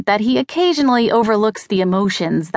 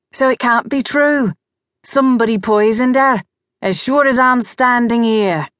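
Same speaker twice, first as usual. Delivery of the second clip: put-on higher-pitched insistent outburst, Australian accent; bursting out; 4 semitones higher than usual. A narrator's reading. Vance insists his daughter was poisoned, bursting out. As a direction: burst out with mild indignation, in character. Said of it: "Vance burst out".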